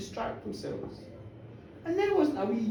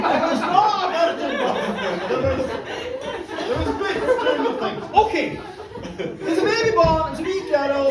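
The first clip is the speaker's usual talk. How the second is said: high, louder, laughing as it's said; 6 semitones higher, 6 dB louder than usual. Real, not acted. Storyteller joking about the birth, laughing. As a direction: laugh through the joke.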